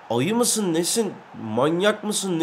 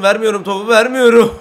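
teasing; 4 semitones higher, 6 dB louder than usual. Real, not acted. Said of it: "dalga geçmeye başladım".

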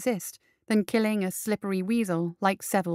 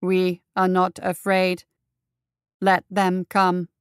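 slow, loud robotic monotone; grinding monotone; 5 dB louder, slower than usual. Acted. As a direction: grind out every word like a machine. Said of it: "grinding out each word in a monotone".

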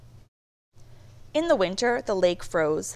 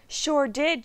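proud; 6 semitones higher than usual. A narrator's reading. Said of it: "Jackie Junior replied proudly".

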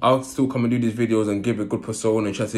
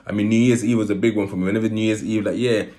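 ordinary talk

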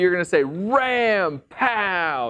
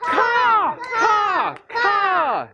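eagle caw; much slower than usual. Shown as a demonstration. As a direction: screech three times like an eagle. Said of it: "just three times".

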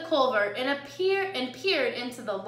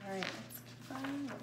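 usual speaking voice

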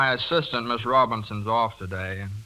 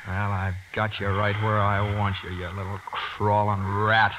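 menacing gloat; 4 semitones lower than usual. Acted. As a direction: savour having him trapped. Dentist gloats over old rival.

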